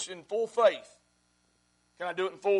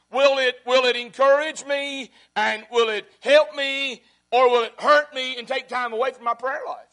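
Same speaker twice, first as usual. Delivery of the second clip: full-force preaching; 3 semitones higher, 7 dB louder than usual. Acted. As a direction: hammer each question home to the congregation.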